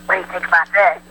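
normal talking voice

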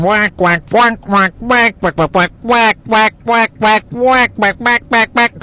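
high, nasal-free duck quack "whack, whack" squawked well above her own voice; quacking woman. Retold or imitated. Mocking the upstairs neighbor's voice as a duck quack. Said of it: "she's just going".

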